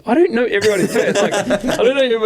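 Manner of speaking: high-pitched, laughing through the words; laughing exasperation. Retold or imitated. Telling a pushy fan off while laughing. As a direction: crack up while protesting.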